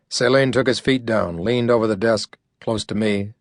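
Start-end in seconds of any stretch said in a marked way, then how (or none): none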